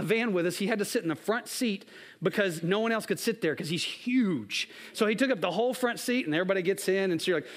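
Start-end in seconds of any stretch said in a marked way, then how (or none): none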